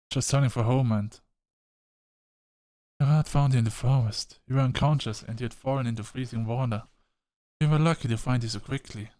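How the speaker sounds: lightly voiced relieved mom; a hint of a mother comforting her daughter.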